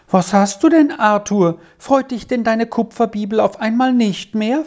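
put-on a touch of a curious child; young girl asking. A narrator's reading. Squirrel asks Arthur why he isn't happy, lightly voiced child. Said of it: "fragte Squirrel".

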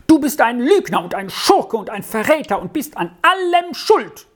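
put-on high, accusing boy's voice; furious boy. A narrator's reading. Boy blaming his companion in a quarrel, furious high outburst. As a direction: rail at him as a raging boy. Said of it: "wütende Bubenstimme".

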